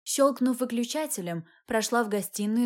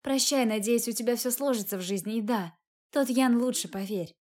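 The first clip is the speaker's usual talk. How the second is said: usual speaking voice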